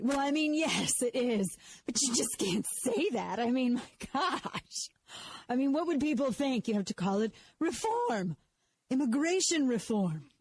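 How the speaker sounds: mildly mocking the Washington insider who forbids the word amnesty; mock scold. Host mocks insiders scolding her, a mild put-on prissy scold.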